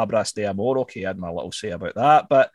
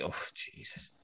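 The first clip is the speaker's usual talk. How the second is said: breathy whispered oh Jesus; whispered dismay; 19 dB quieter than usual. Retold or imitated. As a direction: gasp it under your breath. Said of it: "I was like".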